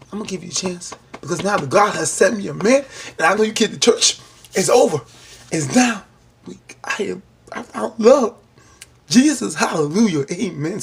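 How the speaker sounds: a churchy burst of joy at finding love; joyful praise. Retold or imitated. Hannah rejoicing she found love.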